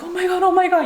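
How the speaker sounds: high-pitched mock panic; retold group panic. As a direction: imitate everyone panicking in a high voice. Retells everybody panicking.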